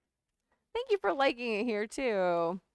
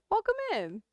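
cheery welcome; 12 semitones higher than usual. Real, not acted. Welcoming a viewer.

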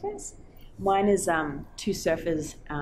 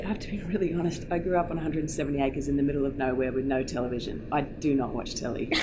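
deadpan confession; 4 semitones lower, 4 dB quieter than usual. Real, not acted. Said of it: "delivering this straight to camera".

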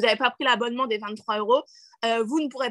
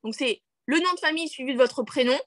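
ordinary talk, nothing marked

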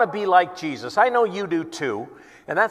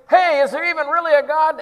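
much higher, loud taunting challenge; heckler; 10 semitones higher, 6 dB louder than usual. Retold or imitated. Evangelist heckling the new preacher, loud taunt. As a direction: shout doubt at the preacher. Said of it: "I'm gonna start heckling you".

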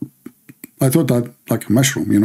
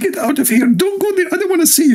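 angry sergeant; much faster than usual. Retold or imitated. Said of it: "He said".

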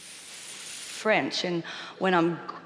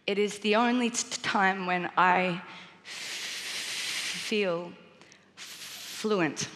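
stutter; much slower than usual. Real, not acted. Speaker with a stutter speaking.

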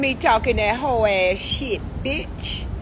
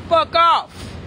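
normal talking voice

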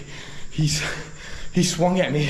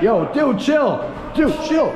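alarmed, high raised voice; urgent shouting; 8 semitones higher, 3 dB louder, much slower than usual. Real, not acted. Yelling at an attacker to chill, urgent shout. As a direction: shout for him to back off. Pleading with man swinging machete.

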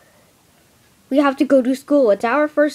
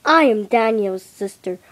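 normal talking voice

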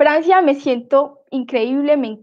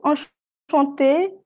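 drawn out greeting; much slower than usual. Real, not acted. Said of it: "greets a student slowly".

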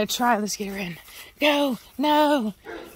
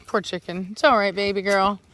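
normal talking voice

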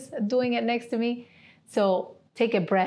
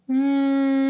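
a steady, louder humming buzz from the throat; bumblebee hum; 3 semitones higher, 4 dB louder than usual. Shown as a demonstration. Yoga teacher demonstrating bumblebee breath, humming buzz. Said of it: "I'm gonna show you first".

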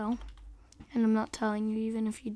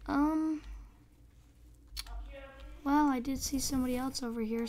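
ordinary talk, nothing marked

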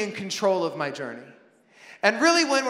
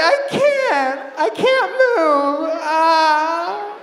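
much higher, louder, slow mock-anguished whine; tormented child; 7 semitones higher, 6 dB louder, slower than usual. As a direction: wail like a child dying of bedtime. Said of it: "she's being tormented and tortured".

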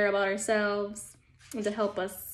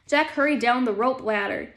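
ordinary delivery, nothing marked

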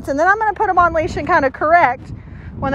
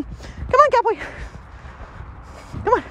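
high sing-song come-here to a dog; upbeat dog-calling voice; high coaxing call; 6 semitones higher, 6 dB quieter than usual. Real, not acted.

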